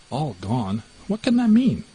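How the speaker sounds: a bewildered cry, rising up high; alarmed disbelief. Acted. The mouse puzzling over the third name, alarmed.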